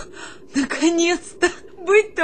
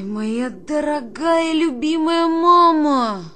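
slow, louder dramatic address; declaimed letter; 2 semitones higher, 6 dB louder, slower than usual. Acted. Soldier theatrically reading a letter to his mother. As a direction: declaim it like a stage monologue.

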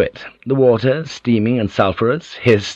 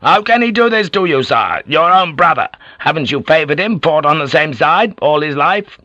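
sudden indignant outburst, higher and louder; bursting out; 7 semitones higher, 4 dB louder than usual. A narrator's reading. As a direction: let the outrage spill over. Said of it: "then burst out".

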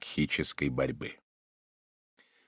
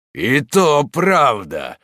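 slow, higher, louder sneer; mocking; 8 semitones higher, 8 dB louder, much slower than usual. A narrator's reading. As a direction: draw out the mockery. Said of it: "насмешливо заговорил Морок".

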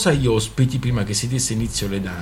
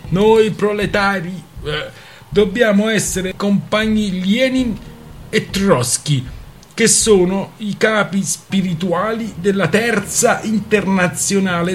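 higher, louder, slow solemn orator; swelling pathetic speech voice; pompous pathetic tone; 9 semitones higher, 4 dB louder, slower than usual. Acted. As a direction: preach it like a grand comrade at a rally. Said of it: "imitando il tono patetico di Svoboda".

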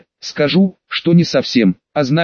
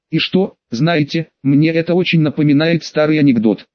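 plain reading voice

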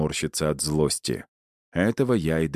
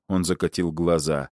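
usual speaking voice

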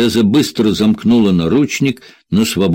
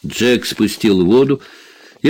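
usual speaking voice

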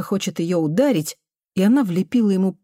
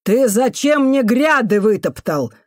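higher, loud accusing voice; angry scolding; 5 semitones higher, 4 dB louder, slower than usual. A narrator's reading. Janitor woman shaking the boy over trampled beds, angry. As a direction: demand an answer, angrily.

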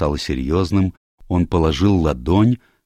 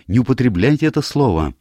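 higher, urgent protest; exclaiming; 8 semitones higher, 4 dB louder than usual. A narrator's reading. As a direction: object quickly to the word. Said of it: "воскликнула Диана".

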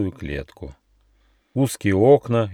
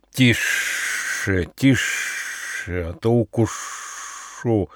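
angry hiss; much slower than usual. A narrator's reading. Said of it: "злобно шипел похититель".

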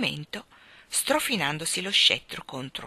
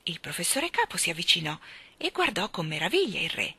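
usual speaking voice

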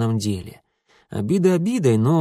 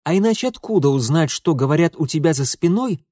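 higher-pitched, crisply articulated reply; icy, pointed comeback; cold retort; 6 semitones higher, 3 dB louder than usual. A narrator's reading. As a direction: rap it out coldly. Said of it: "холодно отчеканил Митя".

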